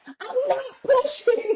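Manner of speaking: high, drawn-out mock wail; stretched squeal of denial, half laughing; wailed protest. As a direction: act out a desperate labor protest. Retold protest while screaming in labor.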